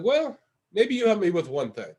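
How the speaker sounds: put-on broken English with a Russian accent. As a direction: imitate a Russian asking a favor. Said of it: "he's Russian".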